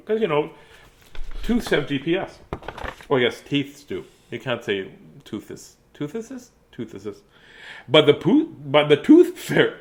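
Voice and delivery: usual speaking voice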